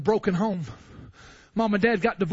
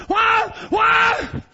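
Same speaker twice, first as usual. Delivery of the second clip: high, loud shouted why and Eli; preacher crying to God in private; anguished cry; 11 semitones higher, 5 dB louder, much slower than usual. Retold or imitated. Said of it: "what I say to God".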